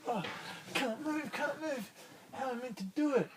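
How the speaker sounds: high, strained whine; a stuck little starfish pleading; high helpless starfish voice; struggling starfish. Acted.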